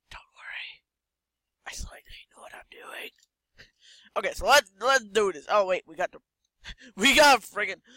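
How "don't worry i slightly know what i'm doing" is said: hushed reassuring whisper. Pretend. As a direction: whisper it close to his ear. Whispering to patient.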